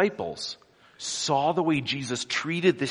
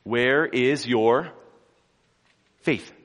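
slow challenge; 2 semitones higher, 5 dB louder, much slower than usual. Acted. Jesus questioning frightened disciples.

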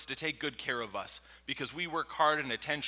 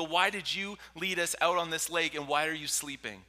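accusing; 5 semitones higher, 6 dB louder than usual. Acted. Disciples blaming sleeping Jesus.

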